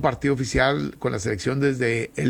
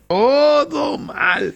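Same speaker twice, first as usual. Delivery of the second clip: high, very drawn-out comic cry; stretched, shouted lament; mock wail; 11 semitones higher, 4 dB louder, much slower than usual. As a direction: wail it out in hammed-up despair. Louder repeat of the mock lament.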